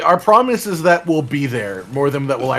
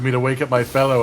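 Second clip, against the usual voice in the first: ordinary talk, nothing marked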